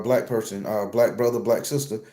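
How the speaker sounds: ordinary talk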